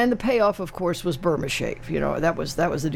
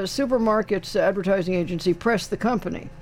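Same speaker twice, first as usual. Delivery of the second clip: ordinary talk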